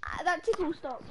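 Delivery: ordinary talk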